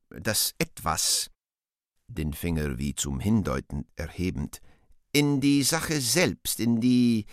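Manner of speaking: words spaced and stressed one by one, "Stachel" and "Gift" pitched high; scanned emphasis. Acted. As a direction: scan it slowly, hitting "Dann", "Stachel", "Gift". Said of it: "eigentümlich betonend und skandierend".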